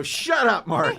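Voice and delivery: loud, high mock snap; mock angry snap. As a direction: bark it like a squabbling pair. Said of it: "they both go".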